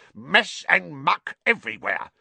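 high outraged caretaker's rant; angry shouting. A narrator's reading. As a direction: rage loudly at the rule breaker. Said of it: "he shouted".

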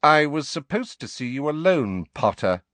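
a touch of a sneer, starting high. A narrator's reading. Snape to Harry about seeing him alone, sneering. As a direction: address the pupil with lightly voiced disdain. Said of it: "the familiar sneer curling his mouth".

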